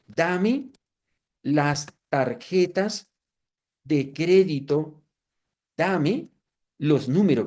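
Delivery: slow, deliberate, word by word like typing; reading out a command slowly; slow dictation. Shown as a demonstration. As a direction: dictate each word slowly as you type it. Dictating a prompt to the AI.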